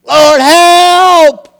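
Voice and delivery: long, high shout for help; exclaimed cry. Shown as a demonstration. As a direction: call out loudly with full exclamation. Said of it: "With an exclamation point after it".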